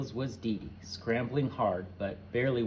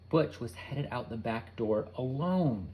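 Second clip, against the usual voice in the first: plain reading voice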